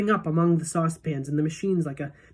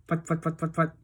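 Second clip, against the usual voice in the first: machine putting sound. A narrator's reading. Narrator voices the machine, putting sound. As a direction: imitate a little engine.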